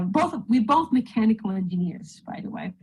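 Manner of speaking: ordinary talk, nothing marked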